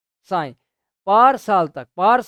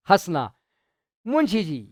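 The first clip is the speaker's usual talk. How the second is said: usual speaking voice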